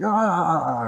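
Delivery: high, loud wavering mock scream; hammed-up cartoon wail; startled broom scream. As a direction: yell as if toppling over. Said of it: "the broom was like".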